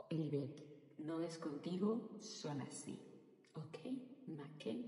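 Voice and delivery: soft self-talk, almost under the breath; calm yourself down quietly; talking to herself. Retold or imitated. Speaker soothing herself about Spaniards' tone, quietly. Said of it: "me hablo a mí misma y me digo".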